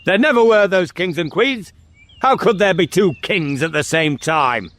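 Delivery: high-pitched, emphatic tirade; angry scolding. Acted. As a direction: lay down the law to a child. King scolds and shakes the boy.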